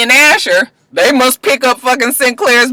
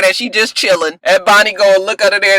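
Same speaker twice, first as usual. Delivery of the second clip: normal talking voice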